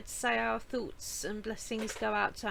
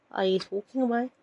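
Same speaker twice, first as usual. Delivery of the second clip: cute pet voice; 6 dB louder, faster than usual. Pretend. Hamster voice playing peekaboo.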